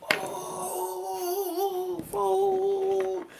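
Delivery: high drawn-out Oh, folk; a long high cheer; crowd cheering. Retold or imitated. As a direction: whoop it like the whole deck cheering. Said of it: "Everybody get".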